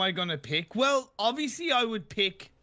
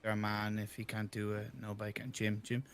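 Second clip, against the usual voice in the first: much lower, mock Birmingham accent like the captain; 10 semitones lower, 10 dB quieter than usual. Player channels captain Jim in a low, badly done Birmingham accent. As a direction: drop the voice deep into a hammed-up Birmingham accent. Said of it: "I can try and put on a Birmingham accent".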